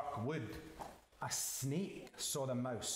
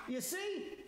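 very high little mouse voice; smug mouse; 14 semitones higher than usual. Acted. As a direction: show off in a high squeak. Mouse shows off to Gruffalo.

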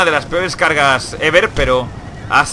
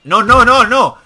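alarmed outcry; 7 semitones higher, 5 dB louder, slower than usual. Real, not acted. Enemy gains advantage.

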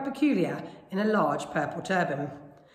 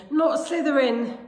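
high, slow, insistent pleading; urgent plea; 7 semitones higher, 4 dB louder, slower than usual. A narrator's reading. Harry begging the hat in thought, urgent plea. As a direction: push the plea higher and stretch it.

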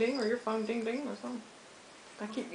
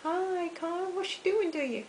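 high-pitched greeting to a pet; pet talk; 8 semitones higher, slower than usual. Real, not acted. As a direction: coo a question up high to the pet.

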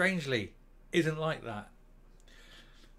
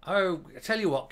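usual speaking voice

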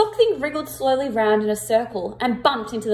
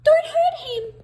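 much higher tiny pleading squeak; little mouse voice; 4 dB louder than usual. Acted. A little mouse begs Kipper, high squeaky plea. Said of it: "a little voice said".

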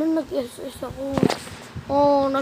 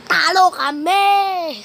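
mock lament; 5 semitones higher, much slower than usual. Lost the match.

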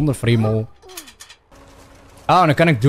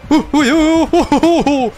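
rapid high "ooh" hoots; string of high whoops; excited hooting; 13 semitones higher, 4 dB louder than usual. Real, not acted. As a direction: hoot quickly, high-pitched.

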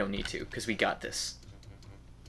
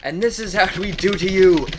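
villainous gloating; 11 semitones higher, 5 dB louder than usual. Pretend.

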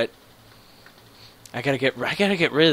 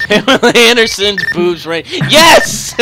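excited shout; 8 semitones higher, 10 dB louder than usual. Real, not acted. Excited answer while laughing.

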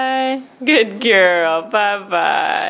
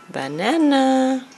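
slow modeled word; 2 semitones higher, much slower than usual. Shown as a demonstration. Modeling word slowly for baby.

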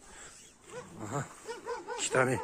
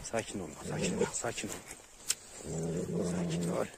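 soothing; 3 semitones lower than usual. Real, not acted. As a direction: settle the dog down gently. Calming his dog near puppies.

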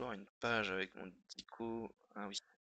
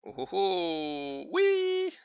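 slow rising oh oh oh, then a high, loud yes; delighted discovery; 7 semitones higher, 8 dB louder, much slower than usual. Real, not acted. Editor finding the illustration he wanted, thrilled cry. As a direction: build from slow wonder to a burst of joy. Finds a photo on Wikipedia.

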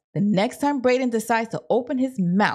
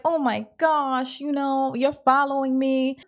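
mock dismay; 6 semitones higher than usual. Acts out woman catching someone following.

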